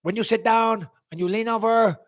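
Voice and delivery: put-on French Canadian accent; George Saint Pierre. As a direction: imitate a French Canadian coach, halting and stumbling. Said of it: "I'll stop the accent".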